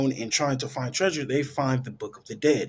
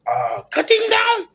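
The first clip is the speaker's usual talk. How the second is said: squeaky, much higher mock voice, louder and slower; imitating the warden; 7 dB louder than usual. Retelling the movie, she mimics the warden in a high squeaky voice. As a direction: jump way up into a silly falsetto, drawn out. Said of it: "That's how he said it".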